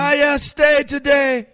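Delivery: put-on slow, very high parrot with a Jamaican accent. Imitating [name]'s parrot greeting, slow Jamaican accent. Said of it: "Did he have a Jamaican accent?".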